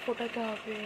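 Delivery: talking softly. Real, not acted. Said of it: "আমি আস্তে আস্তে কথা বলছি". (নিচু গলায় আস্তে আস্তে কথা বলা)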